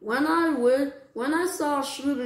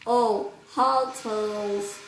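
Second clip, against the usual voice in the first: ordinary delivery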